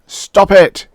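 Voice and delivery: high loud shriek; husband shrieking at his wife. Acted. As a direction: shriek at her to stop. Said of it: "he shrieked".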